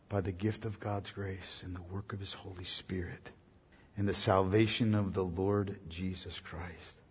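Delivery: whispered close of the sermon; hushed reverence. Acted. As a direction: let it fall to a reverent hush. Hushed solemn ending.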